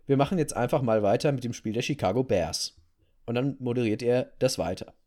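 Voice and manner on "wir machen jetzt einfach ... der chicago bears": normal talking voice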